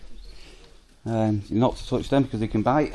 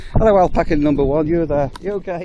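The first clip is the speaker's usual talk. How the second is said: higher, louder cheerful pet greeting; greeting alpacas; 6 semitones higher, 7 dB louder than usual. Real, not acted. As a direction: call hello to the alpaca.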